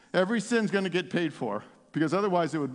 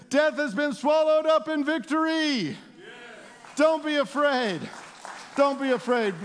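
jubilant preaching; 8 semitones higher than usual. Real, not acted. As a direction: cry it out in triumph over death. Preaching at full force.

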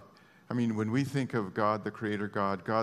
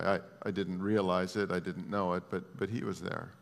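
ordinary talk

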